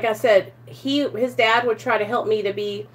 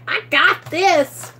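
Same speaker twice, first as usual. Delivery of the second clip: high, drawn-out cocky boast; sure of oneself; 7 semitones higher, slower than usual. Retold or imitated. Recalling false confidence on drugs, acted self-assurance. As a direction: imitate overblown confidence, stretching it out. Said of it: "I was so sure of myself".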